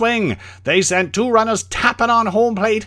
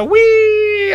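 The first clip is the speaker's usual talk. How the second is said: high, gleeful squeal; roller coaster whee; 13 semitones higher, 4 dB louder than usual. Acted.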